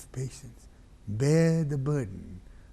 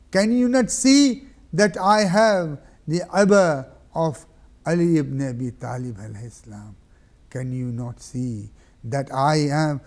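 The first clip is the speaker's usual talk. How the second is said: loud appeal; 3 semitones higher, 10 dB louder than usual. Acted. Hussein challenging the enemy army loudly. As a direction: demand they look.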